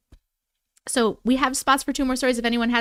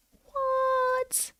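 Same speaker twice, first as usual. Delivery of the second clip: surprised; 12 semitones higher, 8 dB quieter than usual. Real, not acted. Gift goal done surprisingly fast.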